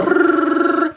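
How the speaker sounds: high, long rolled rrr. Shown as a demonstration. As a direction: trill a rolled R. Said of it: "rolled R".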